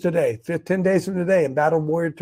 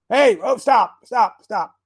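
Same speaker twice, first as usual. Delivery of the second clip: higher, louder urgent call; raised-voice alarm at fumbling gear; calling out stop; 7 semitones higher, 4 dB louder, much slower than usual. Real, not acted. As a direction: call out to halt it, quick repeated stops. Calling out as equipment misbehaves.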